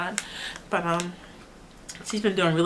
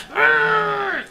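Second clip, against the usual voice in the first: put-on much higher, louder frustrated baby grunt; imitated baby grunt; 14 semitones higher, 4 dB louder than usual. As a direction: grunt like a baby who can't get her way. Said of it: "she goes".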